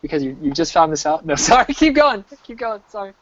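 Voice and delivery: laughing through the words; fighting back laughter; stifled giggles. Real, not acted. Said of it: "I'm trying not to laugh".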